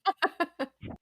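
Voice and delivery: high, loud burst of laughter; laughing. Real, not acted. Game master laughing at the attack on Stubtoe, high laugh.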